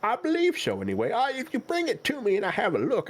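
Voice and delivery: put-on high, wavering old turtle shopkeeper; very old man. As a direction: ramble like an ancient shopkeeper. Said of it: "God, he's ancient".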